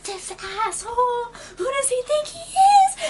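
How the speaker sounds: high-pitched outraged mock shriek; drawn-out, very high incredulous outrage; near shriek. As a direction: squeal it in retold indignation. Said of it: "I almost shriek".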